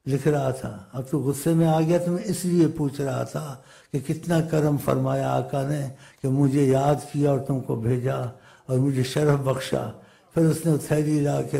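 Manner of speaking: ordinary talk, nothing marked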